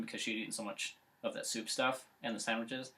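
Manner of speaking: ordinary talk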